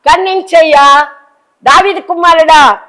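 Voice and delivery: loud pleading cry for mercy; blind man crying out. Retold or imitated. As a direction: call out desperately to be heard. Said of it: "గుడ్డివాడు అడుగుతున్నాడు".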